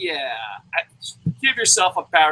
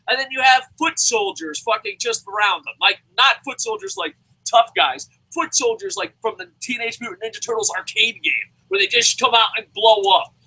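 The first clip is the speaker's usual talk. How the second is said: ordinary talk, nothing marked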